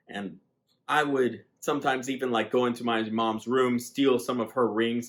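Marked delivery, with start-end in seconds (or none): none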